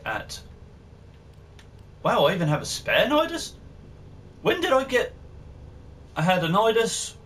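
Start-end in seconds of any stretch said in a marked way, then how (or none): 2.0-7.1 suddenly thrown by a surprise; surprised. Real, not acted. Finds unexpected spare item.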